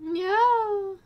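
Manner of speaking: sudden high alarmed no; startled no. Real, not acted. Cat near her tea, she yelps in alarm. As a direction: cry out as the cat nears the tea.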